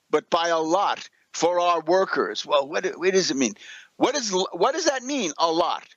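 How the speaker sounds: usual speaking voice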